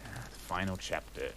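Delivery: ordinary talk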